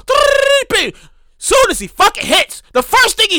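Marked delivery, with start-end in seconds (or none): 0.1-1.0 machine beeps. Retold or imitated. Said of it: "He goes".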